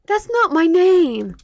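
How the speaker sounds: loud, high, drawn-out objection; indignant protest. Acted. Character protests the nickname given.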